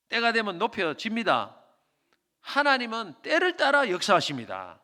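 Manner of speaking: loud, high, ringing sermon delivery; full-force preaching. Acted. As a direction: preach it out to the congregation with fire.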